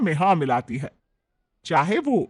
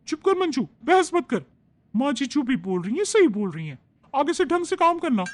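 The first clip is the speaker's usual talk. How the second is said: put-on higher, bossy young bride; young daughter-in-law; 8 semitones higher than usual. Bride scolds the maid Manju, higher and bossy.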